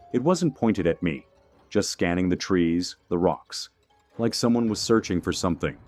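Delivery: ordinary delivery, nothing marked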